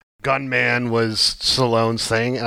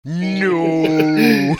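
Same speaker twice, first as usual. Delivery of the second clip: higher, drawn-out mock cry of despair; hammed-up melodramatic long no; Darth Vader; 5 semitones higher, 4 dB louder than usual. Co-host joining in on the infamous movie moment, hammed-up cry. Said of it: "the infamous Darth Vader moment".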